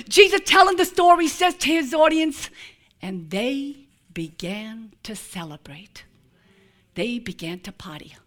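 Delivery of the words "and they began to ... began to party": hushed whisper. Acted. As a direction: let the words fall to a secret hush. Jesus's line read as a hush.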